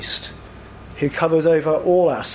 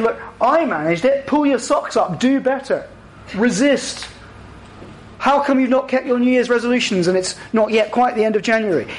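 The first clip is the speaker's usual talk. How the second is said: high-pitched, smug scolding; gloating; 7 semitones higher than usual. Acted. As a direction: gloat over someone who failed where you succeeded. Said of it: "He doesn't gloat over us saying".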